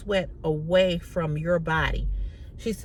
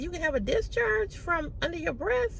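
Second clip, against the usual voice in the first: retold disbelief, voice jumps much higher; incredulous, pitched way up at the end; surprised question; 11 semitones higher, 7 dB quieter than usual. Shocked question to the doctor.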